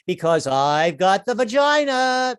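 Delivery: slow, high, loud clincher; smug wife. Retold or imitated. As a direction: deliver the final blow slowly. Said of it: "she pulls out the sex cards".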